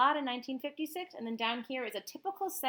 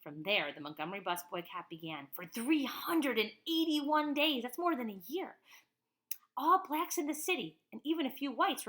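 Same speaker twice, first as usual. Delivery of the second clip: ordinary delivery, nothing marked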